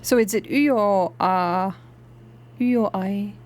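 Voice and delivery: ordinary talk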